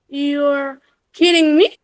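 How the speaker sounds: YOU'RE KIDDING ME climbing at the end; demonstrated upward tune through "kidding me"; rise intonation. Shown as a demonstration. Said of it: "for rise intonation".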